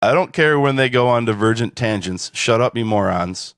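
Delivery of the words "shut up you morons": ordinary talk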